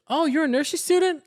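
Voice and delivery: high, syrupy talking-down voice; sugary fake-impressed question; mock condescending coo. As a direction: patronise her sweetly. Retold condescending sister.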